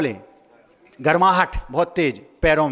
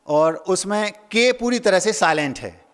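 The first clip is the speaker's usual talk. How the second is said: ordinary talk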